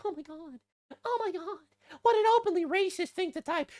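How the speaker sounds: high, shrill-free squeal of mock offense in a falsetto; mocking outraged commenter. Mocking the offended commenters, high mock gasp. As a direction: parody a clutching-pearls online scold. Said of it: "the responses are just like".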